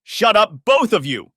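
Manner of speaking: very high, very loud furious shout. A narrator's reading. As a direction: scream them both down. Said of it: "Scylla shouted at her".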